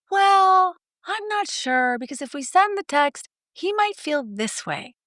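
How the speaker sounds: high, unsure wife voice; hesitant wife. Retold or imitated. Wife hesitating to send the text to husband, high. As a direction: hedge it up high like a worried wife. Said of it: "And she'll say".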